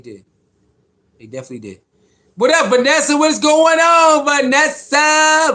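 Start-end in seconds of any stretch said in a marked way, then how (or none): 2.3-5.5 high, drawn-out hype shout-out; excited greeting. Real, not acted. Greeting Vanessa as she joins the livestream, drawn-out and high. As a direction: stretch the name out loud and happy.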